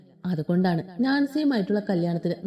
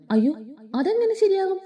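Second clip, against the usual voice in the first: alarmed grandmother; 9 semitones higher than usual. Acted.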